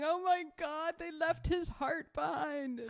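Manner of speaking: high panicked cry, drawn out; freaked-out wail. Retold or imitated. Convict sees frog in shirt, panicked high wail. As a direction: wail in mock horror. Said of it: "he's freaking out".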